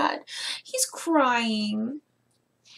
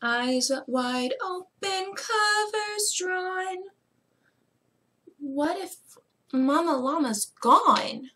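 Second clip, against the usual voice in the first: lilting storybook reading; reading to children; 6 semitones higher, much slower than usual. Acted. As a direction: read it like bedtime story time. Reads the story aloud to children.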